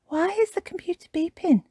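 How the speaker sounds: put-on high little child's question; Peppa Pig. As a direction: ask in a high, curious piglet voice. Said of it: "asks Peppa".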